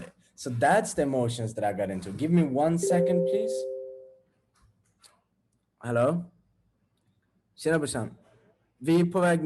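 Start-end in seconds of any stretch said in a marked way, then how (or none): none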